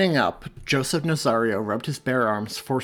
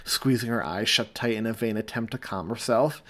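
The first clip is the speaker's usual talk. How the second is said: ordinary delivery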